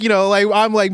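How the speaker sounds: ordinary talk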